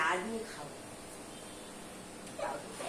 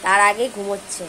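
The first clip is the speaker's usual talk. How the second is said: loud teasing complaint; 9 dB louder than usual. Real, not acted. Mother loudly complains about sleeping daughter.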